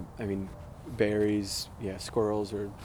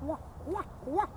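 high hooting owl call; barred owl call; 3 dB quieter than usual. Shown as a demonstration. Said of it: "Almost like a barred owl".

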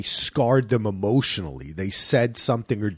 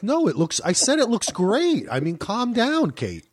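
exasperated reassurance; 8 semitones higher than usual. Retold or imitated. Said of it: "And you're like".

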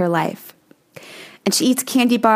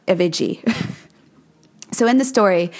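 ordinary talk, nothing marked

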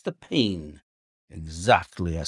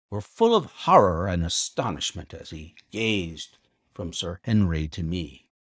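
plain reading voice

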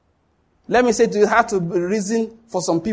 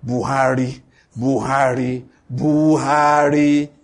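drawn-out mock whine of a name; slow mocking moan; mocking complaint; 4 semitones lower, slower than usual. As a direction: mimic the grumblers stretching the name out. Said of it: "do this nonsense Nigerians do".

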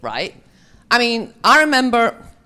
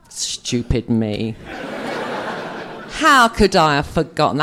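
slow, low, self-mocking; mock self-scolding; 5 semitones lower, slower than usual. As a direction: drag out a sheepish rebuke to yourself. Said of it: "And I went".

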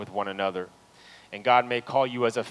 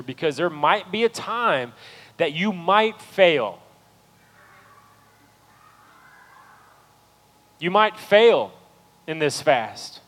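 loud, high, fervent preaching to the church; full-force preaching; 10 semitones higher, 11 dB louder than usual. Real, not acted. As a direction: lift the room, preach it loud to the congregation.

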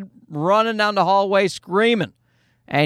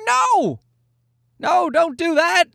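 imitated parental alarm; 12 semitones higher, slower than usual. Parents trying to stop their toddler whipping toys, imitated high-pitched protest. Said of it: "And we're like".

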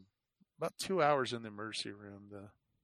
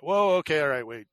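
higher, louder "whoa", then easing down; alarmed halt; 4 semitones higher, 7 dB louder than usual. Retold or imitated. Patient halting the doctor after hearing about arrangements, startled retelling. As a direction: imitate jumping in to stop someone.

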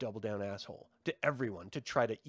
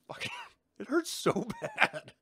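laughing; 4 dB louder than usual. Real, not acted. Laughing recalling painful hit.

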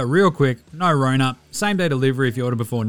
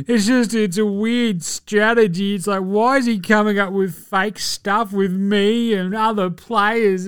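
mocking Skip; 4 semitones higher than usual. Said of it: "he's like".

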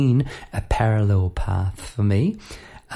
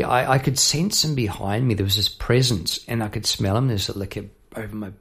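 ordinary talk

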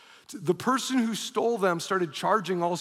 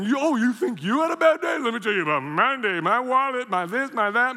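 loud, high, whining complaint; whiny old self; 5 semitones higher, 3 dB louder than usual. Retold or imitated. Pastor mocking his old self, loud high whine. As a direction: whine like a self-pitying man. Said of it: "I would have whined about everything".

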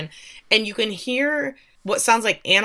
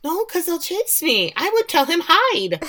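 high-pitched pleading squeal; hammed-up high panicky voice; mock panic; 10 semitones higher, 4 dB louder than usual. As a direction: squeak it out in pretend alarm. Own panicked reaction, acted out.

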